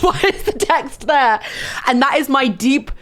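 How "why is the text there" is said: laughing confusion, slower; laughing at her own edit. Real, not acted. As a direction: giggle through the question.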